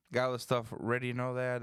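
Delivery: usual speaking voice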